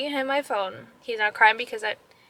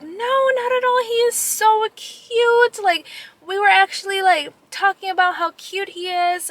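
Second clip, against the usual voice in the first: put-on very high, louder cooing over a cute child; gushing ladies; 8 semitones higher, 3 dB louder than usual. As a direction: imitate sweet strangers gushing in reassurance. Neighboring ladies gush over son.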